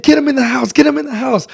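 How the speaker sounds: high-pitched, loud urgent repeated order; frantic retold shouting. Teller rushes to get friend inside, frantic high shout. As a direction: shout in a rising panic to get everyone moving.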